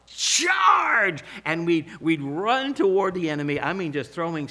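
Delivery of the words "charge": high-pitched, drawn-out charge yell; yelled battle cry. Retold or imitated. Kids running at the snowball enemy, yelled battle cry. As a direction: yell it like leading a snowball attack. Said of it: "we'd yell".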